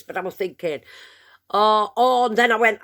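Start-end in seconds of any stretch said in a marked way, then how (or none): 1.5-2.3 pained groan. Retold or imitated. Retold discomfort.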